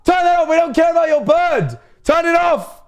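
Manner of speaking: very high, strained shouting; outraged high-pitched yelling; yelling to stop it. Real, not acted. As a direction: shout frantic orders over the disruption. Yelling to get stream image off.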